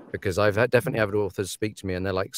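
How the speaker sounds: ordinary delivery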